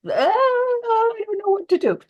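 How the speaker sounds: high, panicky wail; a panicked cry of not knowing what to do. Retold or imitated. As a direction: throw up your hands in mock panic. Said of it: "And I'm just terrified".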